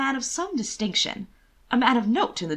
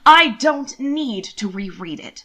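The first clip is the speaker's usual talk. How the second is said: slow, louder, offended retort; incensed; 3 semitones higher, 8 dB louder, slower than usual. A narrator's reading. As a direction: bite off each word in indignation.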